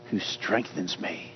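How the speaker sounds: hushed answer. Acted. Answers his own question softly.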